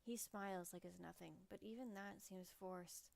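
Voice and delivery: dramatic novel reading; story narration. A narrator's reading. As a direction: read it like a storyteller. Reads visual novel narration.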